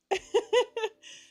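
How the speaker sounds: bright, high laugh; high burst of laughter. Real, not acted. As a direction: laugh it off lightly. Laugh after sarcastic joke.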